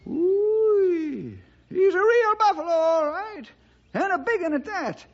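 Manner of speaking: put-on high, awed old-timer voice; an old ranch hand gawking through binoculars; old-timer amazed at the size of the buffalo; amazed old sidekick.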